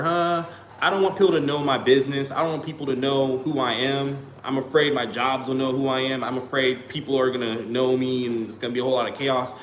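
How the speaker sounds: ordinary talk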